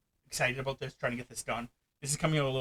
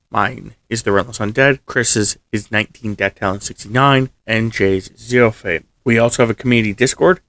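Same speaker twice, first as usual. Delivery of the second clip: loud, low, measured announcer delivery; video outro read; 4 semitones lower, 17 dB louder than usual. Real, not acted.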